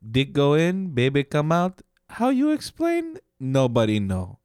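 a silly Frenchman shrugging; mock French accent. Joking with his cohost in a fake French accent. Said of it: "That's why I did that accent".

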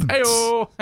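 loud, high hey-o; triumphant cheer. Real, not acted. Cheering after his prison diction joke, loud and high. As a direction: cheer at your own punchline.

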